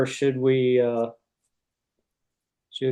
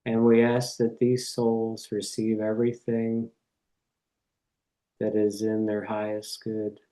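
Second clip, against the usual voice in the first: reverent prayer; 2 semitones lower, 4 dB quieter than usual. Real, not acted. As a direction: pray unhurriedly, phrase by phrase, settling into a hush. Leads an opening prayer.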